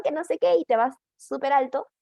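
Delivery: mock shrill little tone; squeaky high voice. Shown as a demonstration. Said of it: "un tonito muy chillón".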